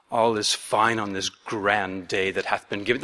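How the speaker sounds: low, pompous British orator voice; a lightly hammed-up British accent, grand and formal; silly British accent. Pastor voicing Tertullus answering in a silly British accent. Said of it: "silly British accent on the guy".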